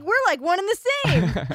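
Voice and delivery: very high, loud gushing agreement; mock delight. Host imitating locals bonding with comic, mock delighted. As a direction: squeal it like a thrilled new pal.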